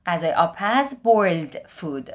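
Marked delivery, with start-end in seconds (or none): none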